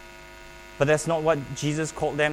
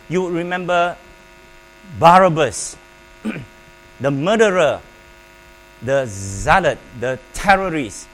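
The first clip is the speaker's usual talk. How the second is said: louder, hammering each name; emphatic preaching; 7 dB louder than usual. Real, not acted.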